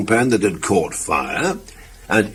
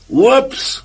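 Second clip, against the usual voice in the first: high, rising startled yelp; sudden high-pitched oops; startled whoops. Acted. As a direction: squeak it out in surprise. Cans of soup tumble.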